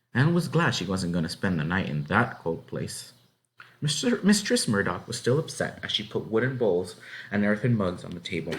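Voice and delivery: ordinary delivery, nothing marked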